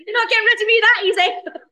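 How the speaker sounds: high, loud, laughing playful jab; laughing tease. Real, not acted. Teasing her friend about tagging along on holiday, laughing loudly. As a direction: laugh while insisting you're coming along. Teasing she's coming too.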